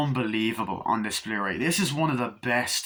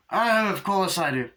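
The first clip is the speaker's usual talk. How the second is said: high, louder mock luvvie voice; pitched-up theatrical luvvie; Eddie Izzard impression; 9 semitones higher, 4 dB louder than usual. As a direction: gush it like a camp director. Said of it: "that sounds more like, uh, Eddie Izzard".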